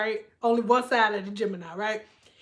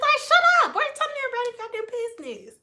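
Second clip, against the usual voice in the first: high, very fast exasperated rant; 12 semitones higher, much faster than usual. Real, not acted. Comic fed up with oversharers, high fast exasperated. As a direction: rattle it off fed up.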